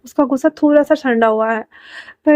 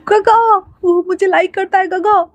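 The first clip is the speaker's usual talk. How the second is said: high, bouncy, gleeful voice; giddy excitement; 8 semitones higher than usual. A narrator's reading. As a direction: squeal it like he's jumping for joy. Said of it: "वांग जी कूदते हुए कहता". (उछलते हुए, ऊँची ख़ुशी भरी आवाज़)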